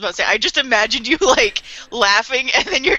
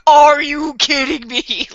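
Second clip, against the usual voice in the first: higher, slow, exasperated disbelief; pissed off; 5 semitones higher, 4 dB louder, slower than usual. Acted. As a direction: drag it out in mock outrage. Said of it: "He is so pissed".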